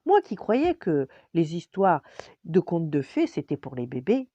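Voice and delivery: loud, lively princess exclamation; excited princess. A narrator's reading. Princess amazed that fairy tales are true, excited.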